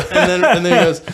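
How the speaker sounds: laughing while telling. Real, not acted. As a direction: talk through the laughter.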